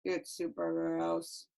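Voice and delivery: low, soft, slow drone; unexcited wrong take. Shown as a demonstration. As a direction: read it with zero excitement. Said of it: "No, that doesn't match".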